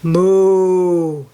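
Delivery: long, drawn-out storybook moo; cow moo. A narrator's reading. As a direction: moo like a cow for a small child. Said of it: "says the cow".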